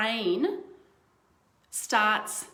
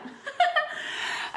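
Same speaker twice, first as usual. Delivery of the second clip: burst of laughter. Real, not acted. Showing the face makes her laugh.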